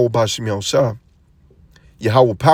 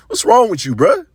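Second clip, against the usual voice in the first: 1 incredulous reaction. Retold or imitated.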